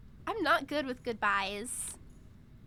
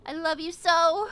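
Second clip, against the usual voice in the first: hammed-up farewell; 3 semitones higher, 10 dB louder than usual. Hammed-up farewell to game pet.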